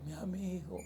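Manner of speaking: hushed tender call; soft greeting. Retold or imitated. Pastor greets his depressed friend on the phone, hushed and tender. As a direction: greet him softly, like a friend at a sickbed.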